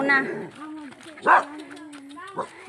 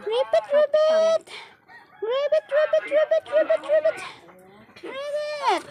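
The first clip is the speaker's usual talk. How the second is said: high frog voice; 10 semitones higher than usual. Pretend. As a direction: play a cute frog. Voicing a frog character.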